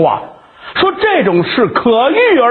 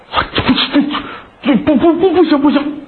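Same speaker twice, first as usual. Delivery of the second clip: stammering, pitched up in a fluster; embarrassed young man refusing; flustered refusal; 6 semitones higher, faster than usual. Acted. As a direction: trip over the words refusing.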